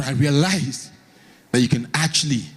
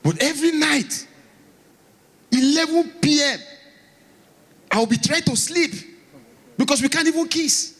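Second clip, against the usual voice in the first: indignant high-pitched telling; 4 semitones higher than usual. Real, not acted. Raised-pitch indignant storytelling.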